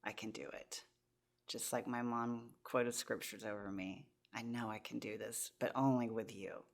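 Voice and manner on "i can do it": whispered prayer. Retold or imitated. Surrendering prayer to God.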